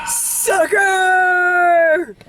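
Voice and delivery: high taunting shout; mock shout. Taunting the movie villain, high mocking shout. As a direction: jeer it at the screen. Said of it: "I was like".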